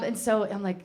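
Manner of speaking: ordinary talk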